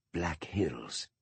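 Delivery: hushed whisper. Acted. Holmes softly translates the name to himself, hushed. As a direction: murmur it almost to himself. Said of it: "whispered Holmes".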